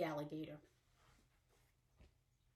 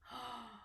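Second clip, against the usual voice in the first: soft surprised gasp; 13 dB quieter than usual. Acted. Surprise before alligator reveal.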